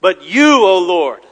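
YOU punched loud, O Lord still strong; emphatic YOU. Shown as a demonstration. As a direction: declare it loudly, leaning hard on YOU. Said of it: "It's very emphatic in the Hebrew here".

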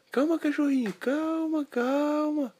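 very high, loud calming; high pleading calm down; soothing boy voice. Acted. Boy soothes angry dog.

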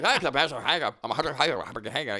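loud, garbled Irish Traveller gibberish; garbled Irish Traveller accent. Acted. Imitating the Snatch movie's Carney people, garbled Irish accent. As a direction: mash the words into thick Traveller Irish. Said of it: "the Carney people and Snatch".

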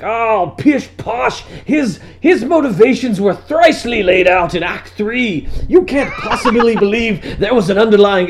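hammed-up grandpa; pompous old scholar. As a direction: scoff like an Oxford don. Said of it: "my grandfather would be like".

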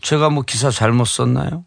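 low, oily mock-suave reply; affected low voice. Reporter answering a politician's complaint, affected low voice. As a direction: imitate a reporter putting on a low voice. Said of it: "목소리 딱 걸어".

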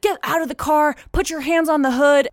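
high-pitched barked orders, imitated; mock police command; hostile cop. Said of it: "super hostile when he pulls me over".

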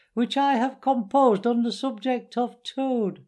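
high-pitched speechmaker announcing a song; high squeaky weasel. A narrator's reading. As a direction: squeak it out to the banquet. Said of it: "began in a high, squeaky voice".

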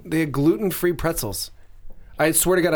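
ordinary talk, nothing marked

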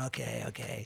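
deflated retold surrender; resigned giving in. Songwriter giving in to record company, resigned.

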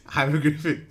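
웃음 섞인 hieroglyphic, 끝까지 못 읽음; giggling while trying the word; laughing through the word. Real, not acted. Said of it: "I can't say that word".

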